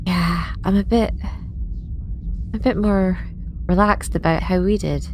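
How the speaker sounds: ordinary delivery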